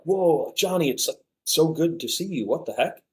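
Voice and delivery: mock delighted surprise, pitched high; surprised greeting. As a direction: re-enact a happy, high-pitched "whoa, it's you!" welcome. Old friends' surprised greeting, acted.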